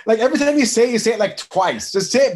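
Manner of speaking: loud, high-pitched exasperation; exasperated scolding. Real, not acted. Co-host fed up with double pronunciation, loud exasperation.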